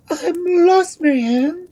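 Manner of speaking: very high, loud queen's wail; panicked royal lament; despairing cry. A narrator's reading. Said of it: "cried the Queen".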